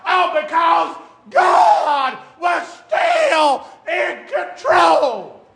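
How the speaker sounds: high, loud, slow climbing sermon shout; preaching at full force. Acted. Preacher shouting hope to the congregation, full force. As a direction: build to a roaring preacher's cry. Sermon climax on God's power.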